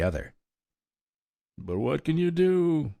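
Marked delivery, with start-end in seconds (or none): 1.6-2.9 whining. A narrator's reading. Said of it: "whined Egeria".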